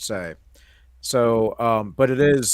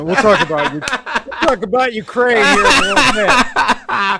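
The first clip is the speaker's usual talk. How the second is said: laughing; 9 semitones higher, 7 dB louder than usual. Real, not acted. Laughs while deferring topic.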